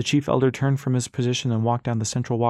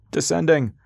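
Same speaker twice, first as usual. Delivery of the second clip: shouted; 5 semitones higher, 3 dB louder than usual. A narrator's reading. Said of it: "a crew member shouted".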